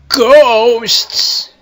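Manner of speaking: loud, high, drawn-out ghostly wail; sudden spooky shout. Acted. Landing the ghost story's punchline as a sudden spooky shout. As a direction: burst out with it to make them jump.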